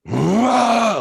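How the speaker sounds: loud, high roar of rage; enraged roar. Retold or imitated. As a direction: erupt in a sudden furious "Ah". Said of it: "like, fucking rage".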